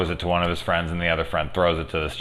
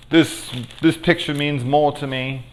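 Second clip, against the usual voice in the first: a shaky attempt at an Australian accent, pitched higher; a failed Australian accent, raised pitch; bad Australian accent; 8 semitones higher than usual. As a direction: fumble through an Australian accent. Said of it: "That's not a good Australian accent".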